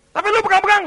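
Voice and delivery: high, loud acted accusation; accusing shout. God catching the devil touching his child, accusing shout. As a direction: shout the accusation over the other's denial.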